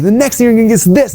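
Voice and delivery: high, excited sales-pitch parody; hyped pitchman. Retold or imitated. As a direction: parody an over-eager stage seller. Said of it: "they're like".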